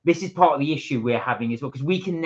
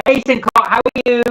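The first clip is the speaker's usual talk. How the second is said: loud, high cheerful hello; excited greeting; 9 semitones higher, 11 dB louder than usual. Real, not acted. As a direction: greet a viewer warmly and loudly. Greeting a viewer in chat.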